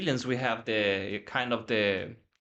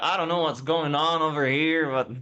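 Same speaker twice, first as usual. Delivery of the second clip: imitating James's accent; 4 semitones higher, 4 dB louder than usual. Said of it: "It was like".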